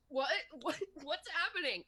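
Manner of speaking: high panicked voice; frantic high questions. Acted. Gidget voiced waking in panic.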